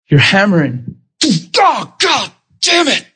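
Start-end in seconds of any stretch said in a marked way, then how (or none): 1.6-3.1 high, drawn-out mock shout of pain; acted frustrated curse. Preacher acts out someone swearing while hammering, acted frustrated curse. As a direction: cry out the oath like a hammer hit your thumb.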